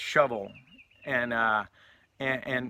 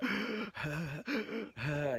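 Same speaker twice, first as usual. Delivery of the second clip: imitated wheezy gasps; labored breathing; 3 semitones higher, 11 dB quieter than usual. Storyteller imitating the cat with breathing problems, labored gasps. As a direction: gasp in and out like a sick animal. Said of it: "with these breathing problems".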